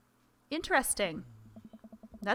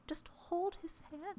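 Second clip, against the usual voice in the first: whisper; 8 semitones higher, 10 dB quieter, slower than usual. Acted. Friend urging dad in theater.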